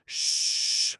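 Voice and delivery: sharp hiss to hush; sharp hushing sound. Acted.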